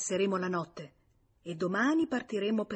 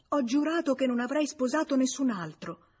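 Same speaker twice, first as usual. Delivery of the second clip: plain reading voice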